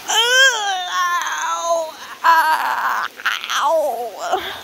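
sharp yelps of pain; pained cries. Real, not acted. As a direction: cry out in pain from cold water. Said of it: "You're so dramatic".

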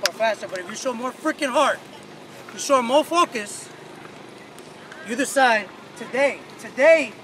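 rally the team, rising fire; building pep talk. Real, not acted. Coach building pregame speech.

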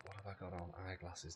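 usual speaking voice

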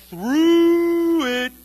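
high, loud, drawn-out through; a long groaning cry; long drawn-out wail. Acted. Preacher stretching through it.

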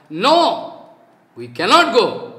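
loud, blunt "no" from hooligans; loud imitated defiant refusal.

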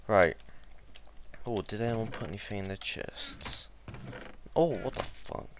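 ordinary delivery

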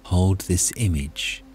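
calm, unhurried meditation guide voice; guided meditation. Acted. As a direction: gently lead listeners inward toward peace.

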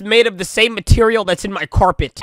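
ordinary talk, nothing marked

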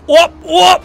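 high, loud alarm call; shouted warning. Pretend. Player spotting intruders at the border, shouting. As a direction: shout to stop someone in their tracks.

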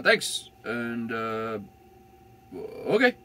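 a surprised little okay; awkward reply. Retold or imitated. His awkward replies to cop.

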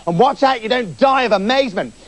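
a brash high-pitched quack doctor proclaiming his skill; boastful doctor. Acted. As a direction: announce it like a pompous quack.

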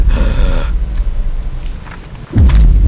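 deep startled gasp; long frightened gasp. Real, not acted. Spots something scary.

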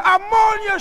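high, loud furious scream; yell. A narrator's reading. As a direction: shriek the word back at him. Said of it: "she yelled".

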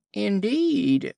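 put-on a pleading young lamb protesting to the wolf; mournful lamb bleat. A narrator's reading. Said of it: "bleated the lamb in a mournful tone of voice".

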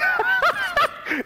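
high burst of laughter; gloating laughter. Real, not acted. Gamer laughs at a downed rival, gloating.

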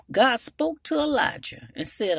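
normal talking voice

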